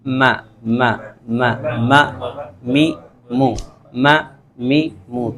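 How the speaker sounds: ما می مو with moist lips closed softly; meem sound demo. Shown as a demonstration. Teacher modelling meem vowels for students. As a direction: hum ما، می، مو from the moist inner lips. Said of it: "تری والا حصہ لگتا ہے".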